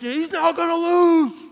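high, loud, slow defiant yell; charging bull shout. Retold or imitated. Son charging at his brother, shouting high and slow. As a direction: roar it back like a charging bull. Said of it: "like a charging bull".

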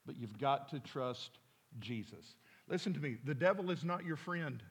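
ordinary talk, nothing marked

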